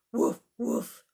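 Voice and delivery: deep gruff woof; low dog bark. Acted. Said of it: "Puddles is coming too".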